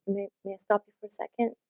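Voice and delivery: quiet polite request to cut in; soft and low. Shown as a demonstration. To someone talking over you, soft low interruption. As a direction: ask gently and softly to pause them. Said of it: "with a soft and low voice".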